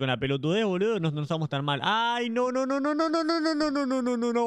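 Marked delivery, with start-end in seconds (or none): 1.8-4.4 rising panicked string of no; real panic, pitch climbing higher; frantic high-pitched no no no; panicked high no's. Real, not acted. Panicking in game.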